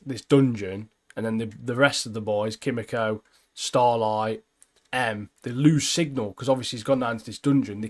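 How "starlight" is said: ordinary talk, nothing marked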